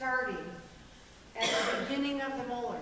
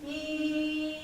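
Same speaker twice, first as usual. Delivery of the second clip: pressed, tight ee; pressed phonation; 4 semitones higher than usual. Shown as a demonstration. Coach showing chorus a pressed sound, pressed. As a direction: squeeze a pressed ee. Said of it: "which is a very pressed phonation".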